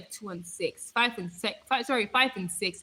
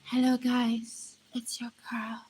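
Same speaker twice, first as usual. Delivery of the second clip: creepy whispery greeting; 5 dB quieter than usual. Acted. Streamer shows viewers how sitting sedately would sound, creepy hushed voice. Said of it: "Can you see how creepy that sounds?".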